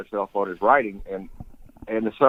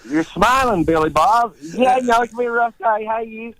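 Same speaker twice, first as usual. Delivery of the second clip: high, comic Appalachian accent through a fixed smile; hammed-up, high-pitched Tennessee twang; Eastern Tennessee accent; 10 semitones higher, 3 dB louder than usual. Shown as a demonstration. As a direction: play cheerful hillbillies with a stiff upper lip. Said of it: "they look like they're smiling".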